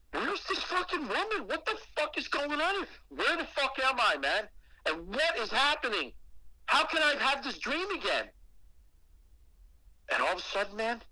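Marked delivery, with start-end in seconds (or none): 0.1-8.3 much higher, breathless run of panicked questions; frantic questions. Retold or imitated. Trapped in the same dream again, high panicked questions. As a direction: spill it out scared and bewildered. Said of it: "I'm like".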